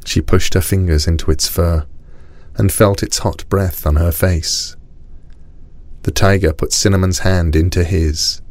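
plain reading voice

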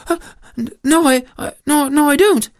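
high, stammering reply; nervous mother. Acted. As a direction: falter in front of the threatening goat. Mother stammers before the goat.

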